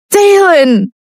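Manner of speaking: high desperate call; calling after him. A narrator's reading. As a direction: shout his name as he leaves. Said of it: "Lucia chased after him".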